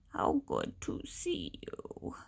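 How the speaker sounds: put-on very low, slow, hushed old voice; frail old granddad. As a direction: answer softly like a weak old badger. Dying granddad replies weakly.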